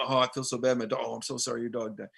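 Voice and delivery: a hint of sympathetic sorrow; mock sympathy. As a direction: pretend condolence, softly. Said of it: "They go".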